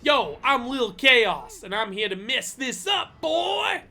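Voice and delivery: loud, slow hype rapper intro. Pretend. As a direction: announce yourself like a hype rapper. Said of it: "your son is a rapper called [name]".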